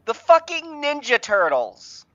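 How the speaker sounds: high-pitched comic outrage; exasperated, high-pitched announcement; incredulous punchline. Acted. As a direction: deliver an absurd reveal in disbelief.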